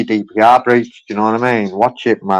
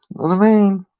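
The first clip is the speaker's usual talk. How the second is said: high mock; 10 semitones higher than usual. Real, not acted. High mocking repeat of catchphrase.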